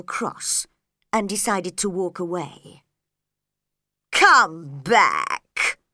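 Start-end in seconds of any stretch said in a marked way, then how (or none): 4.1-5.8 louder call, lower voice; calling after. A narrator's reading. Caterpillar calling after departing Alice, louder. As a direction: call out to someone walking away. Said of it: "the caterpillar called after her".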